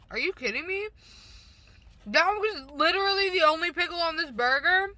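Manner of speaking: rising, outraged at the missing pickles; you can't believe how few pickles came; indignant disbelief. Real, not acted. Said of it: "I'm mad, but whatever".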